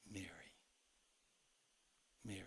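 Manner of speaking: a hushed, loving Mary; tender calling of a name. Retold or imitated. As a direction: call her name as a loving friend would. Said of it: "this is the way he would have said it".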